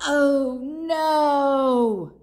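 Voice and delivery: loud, high, long-drawn oh no; dismayed cry. A narrator's reading. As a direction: wail it out in mock dismay. Storyteller cries out in dismay.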